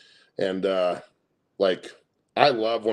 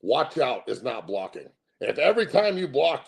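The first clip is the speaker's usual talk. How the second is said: ordinary delivery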